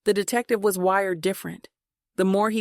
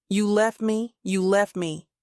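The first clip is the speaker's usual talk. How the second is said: plain reading voice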